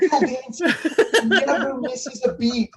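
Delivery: laughing high and loud about the dancer; giggling in disbelief as it's told. Real, not acted. Telling the congregation about the dancer, laughing high.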